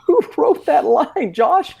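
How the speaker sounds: very high, drawn-out incredulous question; laughing disbelief. Real, not acted. Mocking the movie's script to the co-host, incredulous. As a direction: squeal it slowly in amused disbelief.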